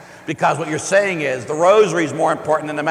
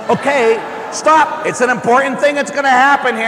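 mock alarm; 8 semitones higher, 6 dB louder than usual. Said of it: "So it's".

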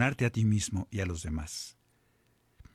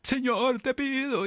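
high, anxious mock pleading to God; mock desperate plea; 13 semitones higher, slower than usual. Priest mimicking a frantic believer begging God, mock desperate. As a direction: imitate a frantic, half-crying prayer. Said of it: "Pedimos agitadamente, casi llorando, gritando".

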